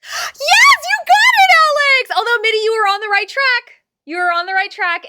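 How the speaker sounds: gasping, high, loud celebration; thrilled outburst. Real, not acted. Streamer cheering viewer who got the quote, thrilled. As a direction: shriek with delight, then stay high and loud.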